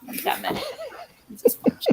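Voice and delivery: playful unvoiced whisper; mock-warning whisper. Guest jokingly warns she will hound people, whispering.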